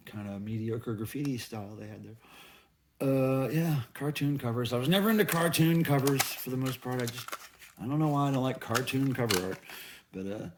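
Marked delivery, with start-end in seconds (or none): none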